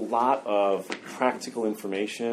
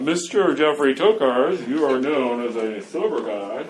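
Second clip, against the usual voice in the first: slow, pompous mock announcement; imagined announcer singling out the sober guy; 3 semitones higher, 4 dB louder than usual. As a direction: call him out like a master of ceremonies.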